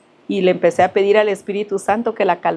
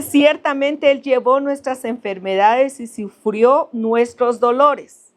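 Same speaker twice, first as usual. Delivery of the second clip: slow, raised solemn reading, stretching the last word; scripture reading; 5 semitones higher, slower than usual. Acted. Preacher repeating the verse to the congregation, slow and solemn. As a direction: draw out dolores at the end.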